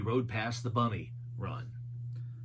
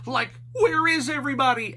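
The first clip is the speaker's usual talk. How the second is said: high goofy Shaggy voice; 10 semitones higher, 5 dB louder, faster than usual. A narrator's reading. Shaggy asking Scooby about a noise, high and goofy. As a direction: ask like a nervous cartoon slacker. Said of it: "asked Shaggy".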